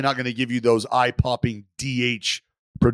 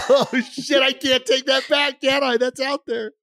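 high, hammed-up mock dismay; 12 semitones higher than usual. Host mocking cohost's accent slip, high mock dismay. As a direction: squeal it high as if mortified.

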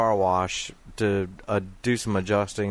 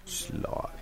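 Russian-accented "Slot" whispered; 13 dB quieter than usual. Shown as a demonstration. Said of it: "And do it in a Russian accent".

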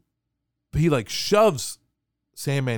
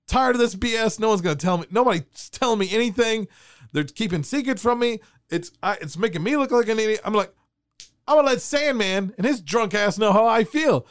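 higher indignant mock rant; 8 semitones higher than usual. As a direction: huff about being kept in the dark. Retold inner rant.

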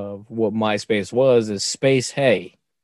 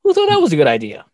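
higher, louder, fast disbelief; incredulous outburst; 6 semitones higher, 4 dB louder, much faster than usual. Retold or imitated. Retelling his reaction to his daughter's sweet spaghetti, incredulous and fast. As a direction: rattle it off in disbelief. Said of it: "I'm like".

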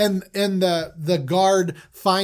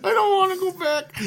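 panicked crying Benjamin; 14 semitones higher than usual. Retold or imitated. Benjamin caught with the cup, hammed-up high sobbing. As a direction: wail your innocence in a mock panic. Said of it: "Benjamin's just losing his mind".

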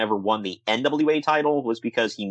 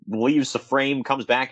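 normal talking voice

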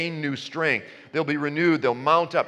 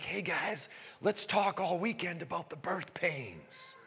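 hushed, lightly mocking group voice; mock conference-goers; 2 semitones higher, 9 dB quieter than usual. Christians at a prophecy conference inviting each other, soft mock enthusiasm. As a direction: softly imitate keen conference-goers.

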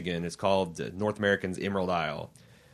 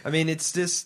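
ordinary talk, nothing marked

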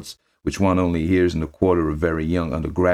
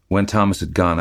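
normal talking voice

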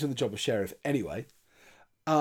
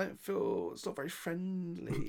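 higher, softer mimicked objection; imitating uneasy hero; 5 semitones higher, 7 dB quieter than usual. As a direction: voice the hero's mild protest, small and high. Voicing film hero's timid objection.

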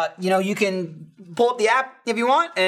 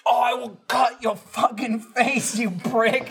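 higher, slow mock threat; imitated loud phone threat; 5 semitones higher, slower than usual. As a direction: lightly imitate the angry man on the phone. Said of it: "He was just very loudly saying".

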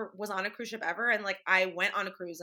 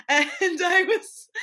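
high, slow laugh through words; laughing at her own story; 7 semitones higher, 7 dB louder, slower than usual. Real, not acted. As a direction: giggle out the words. Laughing while retelling embarrassment.

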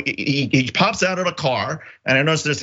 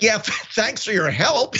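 sarcastic thanks; 7 semitones higher than usual. Retold or imitated. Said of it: "And I'm like".

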